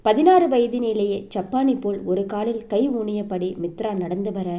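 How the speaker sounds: plain reading voice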